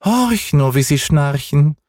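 hohe, langsame Katzenstimme; kleine Katze. A narrator's reading. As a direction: eine Katze erklärt gedehnt von oben. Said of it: "die kleine Katze". (hohe, gedehnte Katzenstimme)

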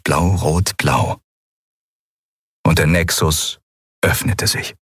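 ordinary delivery

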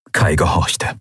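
ordinary delivery

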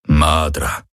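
low rumbling alien voice; rumbling creature. A narrator's reading. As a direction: growl it deep like a beast. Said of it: "brummte es".

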